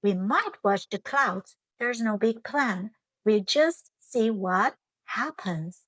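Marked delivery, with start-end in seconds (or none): none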